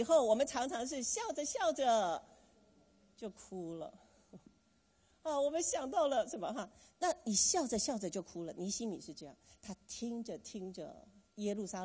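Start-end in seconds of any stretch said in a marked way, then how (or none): none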